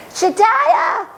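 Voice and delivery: put-on high excited child's call; a boy shouting for the maker as he runs in; boy runs into the workshop calling the maker, high and urgent. A narrator's reading.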